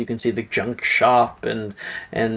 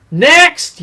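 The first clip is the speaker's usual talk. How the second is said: loud high call of next; 8 dB louder than usual. Acted. Shopkeeper calling the next customer forward, loud.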